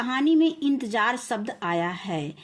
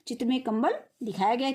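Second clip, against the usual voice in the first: ordinary delivery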